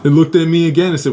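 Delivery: ordinary delivery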